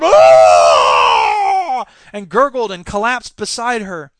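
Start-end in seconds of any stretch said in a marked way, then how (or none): 0.0-1.8 long, loud death howl; mutant howl. A narrator's reading. Mutant hit by a shot, long dying howl. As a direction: howl at full force, holding it out. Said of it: "and the mutant howled".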